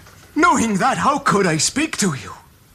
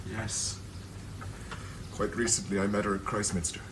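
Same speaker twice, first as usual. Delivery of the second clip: very low, hushed confession; guilty admission; 13 semitones lower, 16 dB quieter than usual. Acted. Man confessing to cousin he met his wife, hushed guilt. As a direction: admit it softly, head down.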